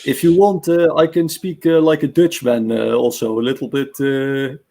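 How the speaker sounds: a hint of a Dutch accent, loud; lightly Dutch-accented English. Shown as a demonstration. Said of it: "I can do the Dutch, uh, accent".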